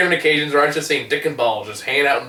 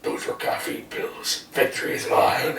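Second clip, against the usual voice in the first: villain voice; 6 dB quieter, slower than usual. Acted. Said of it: "My one-man show".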